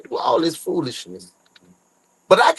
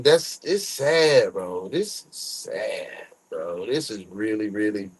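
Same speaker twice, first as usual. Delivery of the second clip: hushed sadness; 9 semitones lower, 7 dB quieter, slower than usual. Real, not acted. Reacting to sad news clip.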